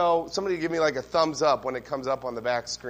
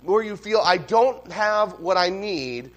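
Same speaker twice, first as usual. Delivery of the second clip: loud, fervent sermon delivery; preaching; 5 semitones higher, 9 dB louder than usual. Acted. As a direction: preach it out to the whole room. Preaching the sermon at full force.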